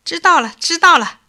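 eager official cutting the story short; hasty agreement. Acted. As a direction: brush it off hurriedly. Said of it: "王爷忙说".

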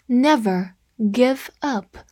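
slow, separated words; slow read-along. Shown as a demonstration. Teacher models a sentence to repeat, slow read-along. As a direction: read slowly, word by word. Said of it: "跟我慢读一遍".

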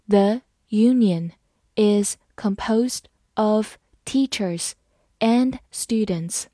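slow phrase-by-phrase reading. Shown as a demonstration. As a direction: break it into slow chunks. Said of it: "好，慢慢来读。". (分段慢读)

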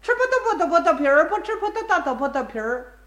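fast tongue-twister patter, rattled off; 绕口令快嘴. Shown as a demonstration. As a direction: show off by rattling it off in one breath. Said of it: "张嘴就来啊". (飞快地说绕口令)

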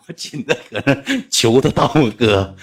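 laughing through the words. Real, not acted. Recounting begging to be a little brother, laughing. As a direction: tell it while cracking up.

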